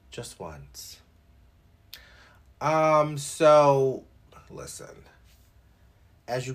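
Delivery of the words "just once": softer, lower repeat of the same words; quiet echo. Shown as a demonstration. Vlogger echoing himself quietly to the camera, quiet aside. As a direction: repeat it to yourself under your breath.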